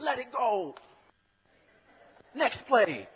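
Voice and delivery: high, loud coach shout from the sideline; a father calling out to his son; dad yelling. Retold or imitated. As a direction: yell it across the field. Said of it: "I can hear him yelling".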